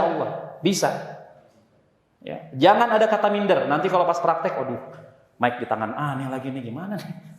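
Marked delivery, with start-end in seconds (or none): 4.6-7.4 nervous student. Retold or imitated. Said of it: "Gemeteran nih".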